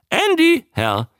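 high squawk on the name; high-pitched creature voice; talking newt. A narrator's reading. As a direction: answer as a croaking newt. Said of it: "sagte der Molch".

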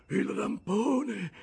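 high, loud, drawn-out demand; strained urgent cry. A narrator's reading. Rescued Ahab half sitting up, calling out with effort. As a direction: call out slowly, high and loud. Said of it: "disse Acab, tirandosi a sedere per metà".

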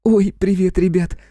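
put-on high-pitched girlish greeting; little sister's high, delighted voice; happy, shy chirp. A narrator's reading. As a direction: chirp hello, joyful and a little embarrassed. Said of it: "Радостно и немного смущённо зачирикала наша дива".

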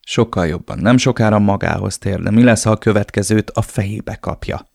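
plain reading voice

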